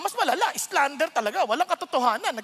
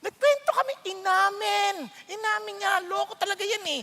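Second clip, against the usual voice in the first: mock gossip; 6 semitones higher than usual. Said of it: "tas ikikwento".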